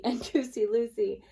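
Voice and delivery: usual speaking voice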